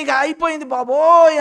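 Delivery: loud, high imitation of hopeless mourners; mock wailing. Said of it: "అని ఏడుస్తారు". (పెద్దగా ఏడుస్తున్నట్టు అనుకరణ)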